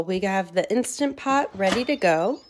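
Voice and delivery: ordinary delivery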